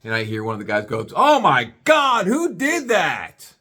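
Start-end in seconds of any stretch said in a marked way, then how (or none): 1.1-3.5 slow, loud, high outraged yell; loud shocked yell. Retold or imitated. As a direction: call out across the room, appalled. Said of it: "Real loud".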